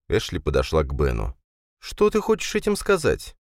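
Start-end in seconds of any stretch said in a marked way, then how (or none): 1.8-3.4 female character voice. A narrator's reading. Ashley voiced by narrator.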